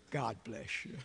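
low, hushed, serene reply; calm wife. Retold or imitated. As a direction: answer a tantrum softly and unruffled, as the wife. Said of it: "She just is the calmest person".

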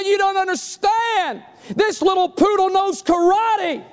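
high, drawn-out protest; hammed-up husband insisting; insistent husband. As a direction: plead the case high and emphatic. Husband protests to angry wife.

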